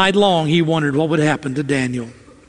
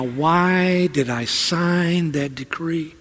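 regretful king; 2 semitones lower, 5 dB quieter, slower than usual. Acted. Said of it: "I can see the king now".

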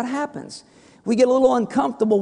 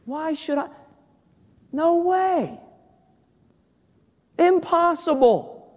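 indignant refusal; 11 semitones higher than usual. Acted. Acted stubborn refusal, building.

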